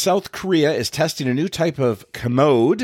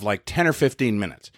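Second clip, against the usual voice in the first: usual speaking voice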